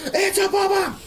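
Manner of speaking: very high, squealing mock alarm; a hammed-up panicked squeal; mock panic cry. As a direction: shriek it like a panicked kid spotting a bomb. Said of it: "And people would freak out".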